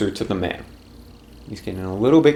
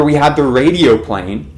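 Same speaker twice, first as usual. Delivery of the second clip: ordinary delivery, nothing marked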